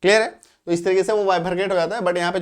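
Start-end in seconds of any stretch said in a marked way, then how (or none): none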